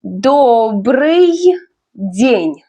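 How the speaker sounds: slow, drawn-out Добрый, pause, then день; repeat-after-me model. Shown as a demonstration. Teacher has learners repeat Добрый день, slow and separated. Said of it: "Repeat after me".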